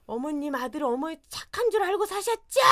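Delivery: loud, high indignant shout; shouting accusation. Retold or imitated. Wife shouts to mother-in-law about her son, indignant. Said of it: "막 이러면서".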